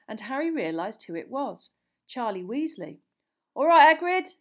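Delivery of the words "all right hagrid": panted greeting. A narrator's reading. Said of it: "he panted".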